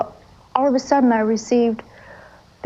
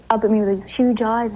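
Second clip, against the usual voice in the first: ordinary talk, nothing marked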